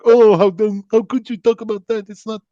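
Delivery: high, indignant mock outcry from the crowd; scandalized objectors. Jordanian audience objecting to comic's taboo topics, mock outrage. Acting out people's complaint.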